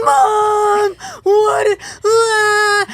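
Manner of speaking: put-on high, loud shrieking kid; kids freaking out. Kids freak out at the lingerie packet, high and loud. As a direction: shriek like a shocked child.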